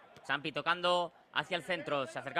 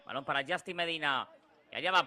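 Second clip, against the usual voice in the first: ordinary talk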